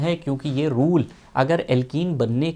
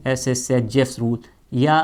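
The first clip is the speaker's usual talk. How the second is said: usual speaking voice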